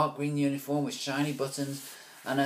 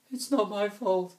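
high, louder whiny voice; mock mumbled excuse; 11 semitones higher, 5 dB louder than usual. Wendy imitating Dad's excuse, whiny. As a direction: imitate a fretful dad.

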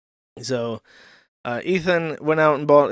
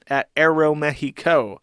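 ordinary talk

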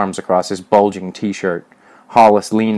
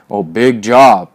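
loud, drawn-out sarcasm; scoff, stretching the words; scornful; 7 dB louder, slower than usual. A narrator's reading. Hollis belittles Alfred's job, loud slow scorn.

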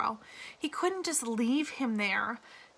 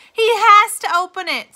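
shrill, much higher and louder, near panic; the warden loses control, shrieking at the lawyer; hysterical outburst; 10 semitones higher, 13 dB louder than usual. A narrator's reading. Warden shrieks at lawyer over suitcase, hysterical yell. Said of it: "She was nearly hysterical".

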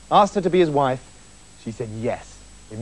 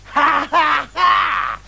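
witch's cackle; 8 semitones higher, much slower than usual. A narrator's reading. Witch gloats over banished Rapunzel, high evil cackle. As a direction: cackle in triumph, high and drawn out.

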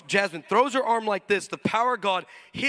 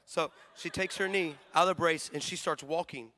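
ordinary talk

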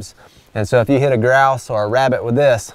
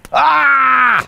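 sudden high yelp; 13 semitones higher, 4 dB louder than usual. Real, not acted. Loud cry, sudden.

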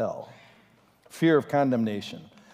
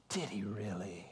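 whisper; 7 semitones lower, 16 dB quieter than usual. Retold or imitated. Satan's doubt whispered.

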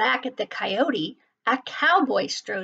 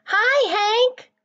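high, cheery sing-out greeting; perky high-pitched hail; calling out; 11 semitones higher, 4 dB louder than usual. A narrator's reading. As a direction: call a bright hello from across the way. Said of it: "Rosie the tumbleweed called out".

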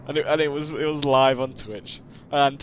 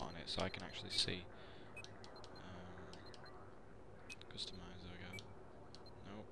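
softly whispered aside; 6 semitones lower, 23 dB quieter than usual. Real, not acted. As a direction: whisper to yourself. Whispering to self.

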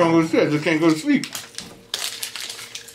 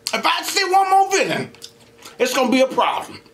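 fed-up superhero; 12 semitones higher than usual. Retold or imitated. Acting out a fed-up Spider-Man.

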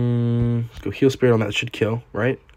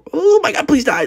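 much higher panicked plea; panicked squeal; 13 semitones higher, 4 dB louder than usual. Real, not acted. Streamer begging the enemy troop to die, panicked squeal.

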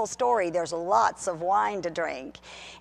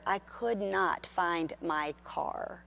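slow emphatic; 5 dB quieter, much slower than usual. Acted. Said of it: "slowed emphatic".

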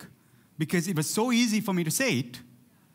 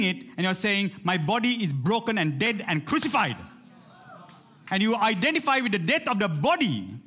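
raised, driving preacher voice; building passionate sermon delivery; preaching at full force; 4 semitones higher than usual. Acted. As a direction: preach it hard to the congregation. Preaching rising to a climax.

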